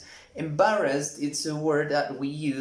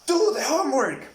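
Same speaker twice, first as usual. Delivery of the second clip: high, loud mock scolding shout; mock angry parent; 10 semitones higher, 5 dB louder, faster than usual. Angry parent shouting at child about homework, a mock shout. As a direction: bark the order like an angry parent. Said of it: "and they say".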